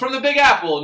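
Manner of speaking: high, loud New York caricature; mock New York accent. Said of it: "New Yorkers don't say that".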